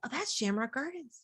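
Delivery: lightly voiced daughter, hushed; daughter realizing. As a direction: notice it quietly as a kid. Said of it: "she goes".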